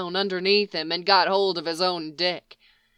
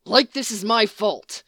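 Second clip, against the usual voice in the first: hissed; 3 semitones higher than usual. A narrator's reading. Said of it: "Dean hissed back".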